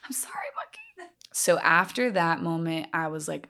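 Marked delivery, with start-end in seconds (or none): 0.0-1.1 tiny high whispered apology; mock apology. Joking apology to the monkeys after a laugh, tiny high whisper. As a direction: whisper it sheepishly, half laughing.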